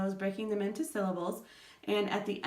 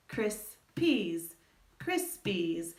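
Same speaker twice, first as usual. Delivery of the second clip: cris, peas said apart, then crispies joined as one word; separated syllables merging into crispies; syllables then blended; much slower than usual. Shown as a demonstration. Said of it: "just say that part".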